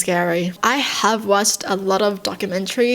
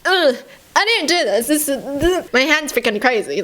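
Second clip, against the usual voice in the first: higher-pitched mock-horrified protest; panicked excuse; 5 semitones higher than usual. Explaining a punch the hand threw, mock panicked. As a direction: act out a flustered, disbelieving denial. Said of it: "You can't be like".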